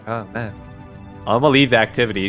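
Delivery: normal talking voice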